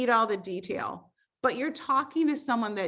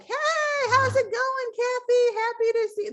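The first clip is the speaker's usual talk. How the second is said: high, bright-eyed hello, much higher and louder; excited sing-song greeting; 12 semitones higher, 4 dB louder than usual. Retold or imitated. Coach voicing a cheery check-in to a customer, excited. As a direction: gush hello like greeting an old friend. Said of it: "you are like".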